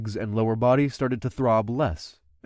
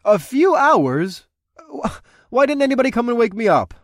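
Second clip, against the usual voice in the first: surprised; 11 semitones higher, 5 dB louder than usual. Acted. Surprised outburst.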